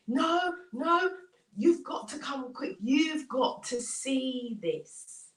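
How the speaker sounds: high opening, slowing to emphasis; acted excited urging; urgent insistence. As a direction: insist breathlessly that they must look.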